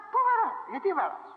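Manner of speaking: high-pitched indignant questions; acted challenge. Challenging a man who fires at anything, acted indignation.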